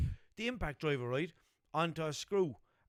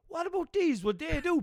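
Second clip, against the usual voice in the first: higher and louder, mock excited quote; imitating Chrissy; 11 semitones higher, 5 dB louder than usual. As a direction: play the mate offering new drills, pitched up and loud. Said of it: "Chrissy turns around to me and goes".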